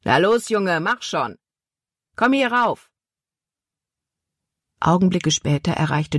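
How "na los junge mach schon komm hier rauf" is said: mildly raised call, pitch swooping high. A narrator's reading. Man at the top hurries the boy upstairs, calling down. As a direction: urge him up with arching, impatient calls. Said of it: "rief zu ihm hinunter".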